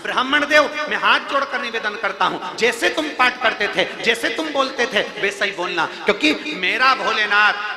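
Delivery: loud, impassioned sermon voice; fervent preaching. Acted. Preacher pleads with the devotee, rising fervor. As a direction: pour out devotion to the crowd.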